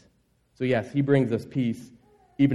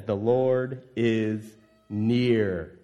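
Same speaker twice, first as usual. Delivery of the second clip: very slow, intoned; 5 semitones lower, much slower than usual. Retold or imitated. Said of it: "Paul says".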